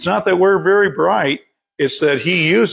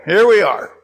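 usual speaking voice

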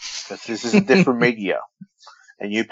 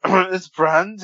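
higher, slow mock leer on "It's Brandy"; imitating creepy voice; 9 semitones higher, 4 dB louder, slower than usual. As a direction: mimic his creepy drawl. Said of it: "How you did was".

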